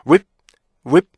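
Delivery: "whip" cut dead on a closed P; short, loud "whip", shut off at the lips; stopped syllable. Shown as a demonstration. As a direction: block "whip" so it cannot lengthen. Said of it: "이렇게 막았죠".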